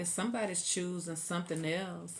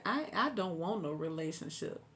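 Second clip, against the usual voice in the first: ordinary talk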